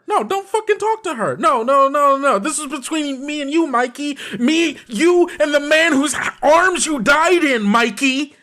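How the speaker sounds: high-pitched, worked-up angry protest; angry outburst. Acted. Nash confronting Mikey for faking death, high and furious. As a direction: shut them out in high, heated anger.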